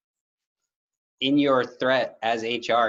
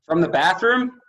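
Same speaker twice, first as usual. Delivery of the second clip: surprised; 9 semitones higher, 7 dB louder than usual. Real, not acted. Teasing incredulous question.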